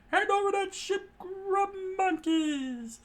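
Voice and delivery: high scream, dragged out at the end; screamed demand. A narrator's reading. Crew screams at Luffy for the ship, high and slow. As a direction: scream it high, drawing out the insult. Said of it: "they screamed out at Luffy".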